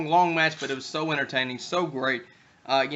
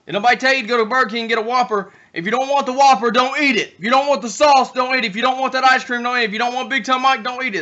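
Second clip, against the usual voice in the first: rant; 6 semitones higher, 5 dB louder, faster than usual. Acted. Ranting at complainers.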